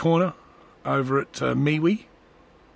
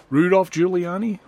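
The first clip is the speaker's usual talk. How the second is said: ordinary talk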